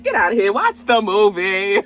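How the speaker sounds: loud, playful command; mock order. Host sending viewers to watch the movie, loud mock order. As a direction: shoo them off loudly, about to laugh.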